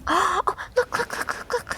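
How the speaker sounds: high, excited gasping "look"; bursting with excitement. Real, not acted. Spots something exciting in game.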